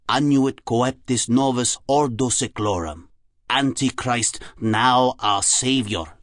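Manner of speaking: put-on slow, measured German-accented recital; scornful German-accented professor; sneering Germanic accent. A narrator's reading. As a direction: intone each phrase with a German accent, pausing between. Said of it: "he sneered".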